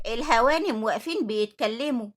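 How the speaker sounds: loud, angry telling-off of idle workers; scolding yell. Acted. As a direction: raise your voice and scold them. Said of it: "اتكلمت نعمات بزعيق وقالت".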